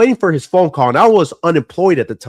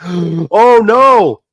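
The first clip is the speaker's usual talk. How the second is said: high, loud shocked cry of disbelief; reliving the panic of a missed call, voice shooting up; stunned son finding out his dad came and went; 10 semitones higher, 6 dB louder, slower than usual. Retold or imitated. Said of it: "I was like".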